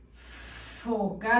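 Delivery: "foca" with the f drawn out like an angry cat; long hissed f. Shown as a demonstration. Teacher dictates with the angry-cat f, "foca" with long hissed f. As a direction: hold the hissing f before the rest of "foca". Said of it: "el gato enojado".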